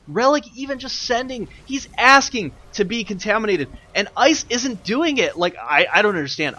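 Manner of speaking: loud, high-pitched incredulous commentary; exasperated disbelief. Real, not acted. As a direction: rail at the missed chance, high and loud. Commentator baffled by player's inaction.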